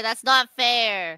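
usual speaking voice

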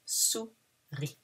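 "Souris" said "suri", soft, no S; soft falling "Souris"; quiet model repeat. Shown as a demonstration. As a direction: murmur "Souris" without the S. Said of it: "We don't pronounce the S".

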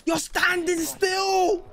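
drawn-out shout at a still target; slow disbelieving shout. Real, not acted. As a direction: yell it slowly in disbelief. Shouting at enemy.

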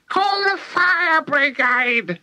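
put-on very high, screeching old woman; sudden shout. A narrator's reading. Grandma with burning stomach, shouting suddenly. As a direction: screech it out, slow and drawn. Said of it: "she shouted suddenly".

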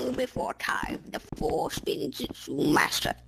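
hushed deep epic narration; low slow trailer read; dramatic trailer voice. Pretend. Dramatic intro narration parody.

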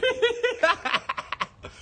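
high burst of giggling laughter; real laughing fit. Real, not acted. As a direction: crack up in a high cackle. Cracks up at the word gallery.

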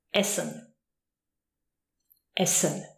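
"Essen" with a voiceless double S; sharp S. Shown as a demonstration. Teacher giving an example of double S, sharp sound. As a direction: say "Essen" with a hissed ss, never buzzed. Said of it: "the sharp version of the S".

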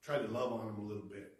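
normal talking voice

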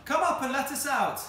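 high, loud plea hollered down; shouting. A narrator's reading. Cyril shouts down to rescuers from tower, loud. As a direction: shout to be let out. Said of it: "shouted Cyril from the church tower".

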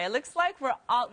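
ordinary talk, nothing marked

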